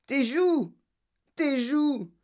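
high-pitched wail of alarm; panicked cry. A narrator's reading. Mother seeing cheeks turn purple, panicked cry. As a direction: wail each one high, falling at the end. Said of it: "hurla madame Beauregard".

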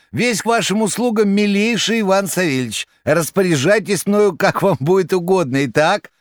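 high, fawning rattling voice. A narrator's reading. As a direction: grovel with exaggerated politeness. Koroviev on phone.

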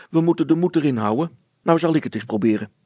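put-on loud, quick, upbeat boy; cheerful. A narrator's reading. Hannes offers to take a turn, cheerfully. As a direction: keep spirits up, briskly. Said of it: "zei Hannes opgewekt".

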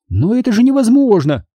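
high-pitched indignant exclamation; high outraged voice. A narrator's reading. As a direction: protest it high, appalled. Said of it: "возмутилась Минди".

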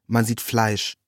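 plain reading voice

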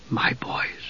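stunned, grieving whisper; all breath, barely there. A narrator's reading. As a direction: whisper it in shock. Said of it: "he whispered".

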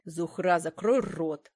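curt male rebuke; irritated, sharp order; snapping. A narrator's reading. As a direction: cut her off with a snap. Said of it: "огрызнулся Зафар".

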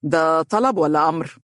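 mock-stern question; comic sternness. A narrator's reading. Husband to bossy wife, asking if it is an order, mock stern. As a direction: put on a slightly funny strictness. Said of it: "وقال بصرامة مضحكة".

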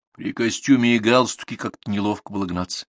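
ordinary delivery

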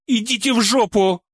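высокий злой женский голос; огрызнуться резко и высоко; зло огрызаясь. Acted. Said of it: "зло огрызнулась бывшая продавщица". (зло, огрызаясь)

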